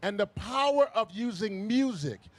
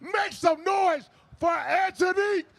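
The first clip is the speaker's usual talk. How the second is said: building hype introduction; rising announcer build-up; rally speech; 3 semitones higher than usual. Real, not acted.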